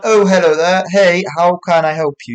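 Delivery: over-polite phone manner; phone-answering voice. Shown as a demonstration. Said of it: "answer the phone very regularly".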